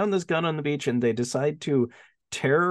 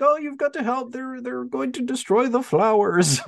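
higher, lightly voiced fretful old man; panicked old groundskeeper; 8 semitones higher than usual. Groundskeeper begs police to save his flowers, hammed-up panic. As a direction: plead for the flowers in a mock panic.

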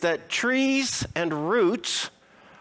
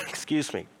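chesty cough; 4 dB quieter than usual. Real, not acted. Speaker nursing a cold coughs.

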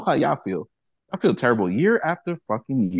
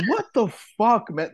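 incredulous; 8 semitones higher than usual. Retold or imitated. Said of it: "I'm just like".

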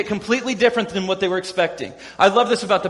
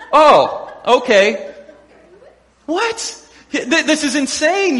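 mock shock; 6 semitones higher, much slower than usual. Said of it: "reacting to the demand".

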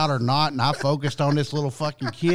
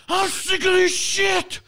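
Hulk Hogan rant; slower than usual. Retold or imitated. Man imitating the kid's Hulk Hogan rant, slow high yell. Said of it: "he goes full-blown Hulk Hogan".

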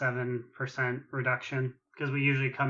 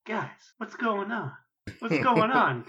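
imitating a very defeated raid leader, higher pitched; Lynn; 7 semitones higher than usual. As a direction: plead in mock defeat, voice raised in pitch. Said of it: "a very defeated Lynn was like".

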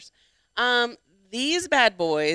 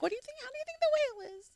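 high silly voice; 10 dB quieter, much faster than usual. Pretend. Mock baby voice to partner.

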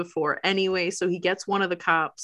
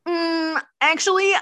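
mock-reluctant, pitched up; hesitant refusal; 8 semitones higher than usual. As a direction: imitate someone politely backing out. Retelling her timid refusal.

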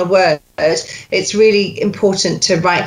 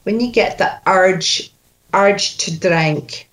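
Scottish accent. Shown as a demonstration. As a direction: model it in thick Scottish. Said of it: "just reeks Scottish".

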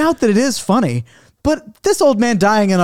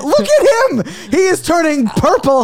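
very high, frantic exclamation; acted high-pitched alarm; 9 semitones higher than usual. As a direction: point out the emergency in a panic. Alarm at injured man.

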